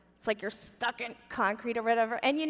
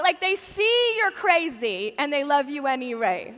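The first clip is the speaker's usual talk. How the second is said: ordinary talk, nothing marked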